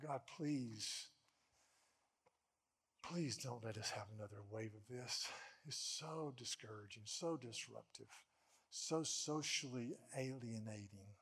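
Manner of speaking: low, subdued plea to God; weary groaning plea. Retold or imitated. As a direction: plead quietly, worn down and discouraged. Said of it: "I, I groaned".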